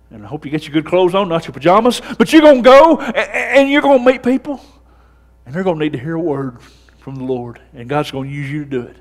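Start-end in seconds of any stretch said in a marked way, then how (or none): 2.2-4.6 loud, high Southern preacher's build; preaching at full force. Acted. Pastor urging his congregation, loud and rising. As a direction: drive it home to the congregation.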